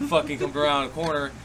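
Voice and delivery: ordinary talk, nothing marked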